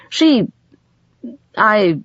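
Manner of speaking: high, broken-off starts with long pauses; hesitant. A narrator's reading. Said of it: "he hesitated".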